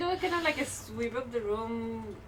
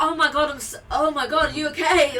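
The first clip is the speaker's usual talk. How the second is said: loud, higher overdone concern; fake concern; 4 semitones higher, 12 dB louder than usual. Cleric faking concern while helping the tripped man, loud and hammy. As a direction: ham up the worried helper. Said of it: "I did it, and I'm like".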